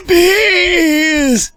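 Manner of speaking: very high, drawn-out mock squeal; strained squeal. Allergic patient answering the doctor, strained high squeal. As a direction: squeeze it out in a tiny high voice.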